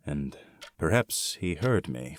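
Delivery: usual speaking voice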